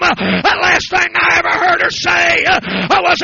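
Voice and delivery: loud, driving preacher cadence; hoarse-free fiery sermon shout; preaching at full force. Acted. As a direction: press the altar call hard.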